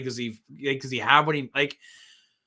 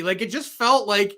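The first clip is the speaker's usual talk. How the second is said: ordinary talk, nothing marked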